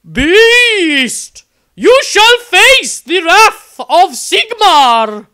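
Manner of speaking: high, loud and slow, a holy warrior's challenge; ringing battle cry. A narrator's reading. Sister of Sigmar challenging the beast man, high ringing battle shout. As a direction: bellow it down the ship like a zealot. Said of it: "A woman's voice rang out".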